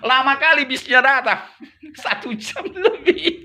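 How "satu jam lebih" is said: slow words through laughter; laughing, drawn out. Real, not acted. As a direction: laugh the long wait out. Laughing at long wait.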